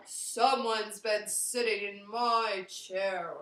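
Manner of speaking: big slow loud bear voice; slow Papa Bear. Acted.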